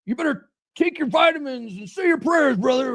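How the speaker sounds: high, loud wrestling promo yell; Hulk Hogan impression. Wrestling fan mimicking Hulk Hogan's loud promo, hammed up. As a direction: channel a hyped-up wrestler hollering. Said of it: "Like, really loud".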